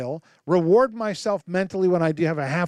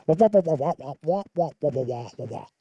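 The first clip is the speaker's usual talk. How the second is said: Charlie Brown teacher voice; 2 semitones lower than usual. Retold or imitated. The father hears the son's speech as Charlie Brown wah-wah. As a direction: make the son's words into meaningless wah-wah noise. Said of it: "it sounds like this to God".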